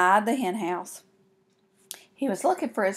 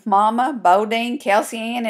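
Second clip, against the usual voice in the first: usual speaking voice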